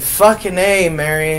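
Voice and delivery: re-enacted exasperated cursing; exasperated outburst. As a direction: act out being fed up, loud and high. Retells scolding crying Mary at night.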